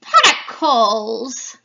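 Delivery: loud, slow witch voice; drawn-out crone call; second witch. Acted. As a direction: croak it like a hag. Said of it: "introduce you to the three witches".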